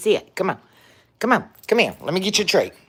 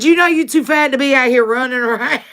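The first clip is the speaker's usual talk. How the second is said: laughing mock scold; 6 semitones higher, 5 dB louder than usual. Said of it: "She said".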